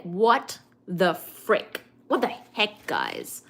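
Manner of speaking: slow, drawn-out mock disbelief; mock anger. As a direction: act mildly outraged, word by word. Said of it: "I'll be mad".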